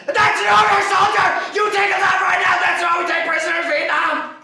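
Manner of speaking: loud, high drill sergeant yell; screamed order. Retold or imitated. Soldier retells his sergeant screaming the order at him.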